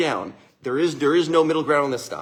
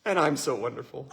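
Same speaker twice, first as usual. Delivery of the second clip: amused; 6 dB quieter than usual. Retold or imitated. Daughter praising herself.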